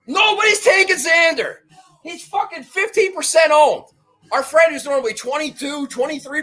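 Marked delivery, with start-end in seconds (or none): 0.1-3.8 excited shout. Real, not acted. Hyping his bet to listeners, excited shout. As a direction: shout it out like a sure thing. Hyped golf bet pitch.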